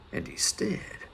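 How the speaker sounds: "and he stared" breathed as a whisper. A narrator's reading. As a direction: whisper it, stunned. Repeated staring, building suspense.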